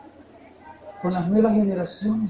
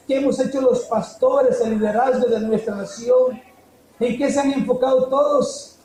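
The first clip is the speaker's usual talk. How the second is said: raised, rhetorical preacher's questions; preaching at full force; 4 semitones higher, 5 dB louder than usual. Acted. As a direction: hammer the questions at the congregation. Pastor challenging the nation's adults. (predicando con fuerza, preguntas retóricas)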